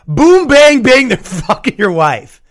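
slow boom, then loud high bang bang; building to a loud comic climax; punchline build. Acted. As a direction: slam the punchline home.

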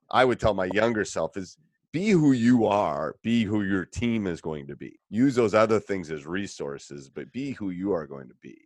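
ordinary talk, nothing marked

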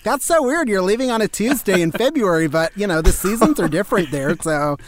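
high, lightly put-on classmate; a hint of a gullible student; mocking classmate voice. A hint of a classmate teasing the fake Australian. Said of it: "And they're like".

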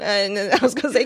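ordinary delivery

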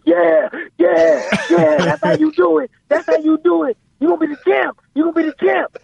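very high-pitched imitation of dad cheering; hyped-up dad. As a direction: cheer him on like an overexcited father. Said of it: "he just get all hyped up".

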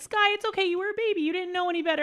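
high-pitched soothing mom voice; reassuring mom. Retold or imitated. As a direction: comfort a small child, pitched well up. Mother retelling comforting her daughter.

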